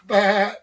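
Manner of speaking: loud mock goat bleat. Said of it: "sounded like a goat".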